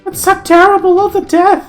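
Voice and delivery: high, tearful wail; crying queen. Acted. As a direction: sob it out as the grieving queen. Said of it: "starts to cry and says".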